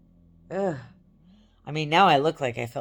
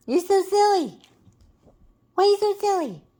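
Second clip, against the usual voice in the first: high, louder cooing pet voice; 7 dB louder than usual. Real, not acted. Owner cooing at her silly dog, high baby talk.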